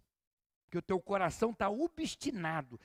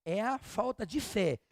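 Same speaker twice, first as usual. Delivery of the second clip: ordinary delivery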